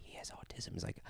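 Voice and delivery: hushed, voiceless whisper; whispered aside. Acted. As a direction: whisper it like a secret at a concert. Whispered aside in acted quote.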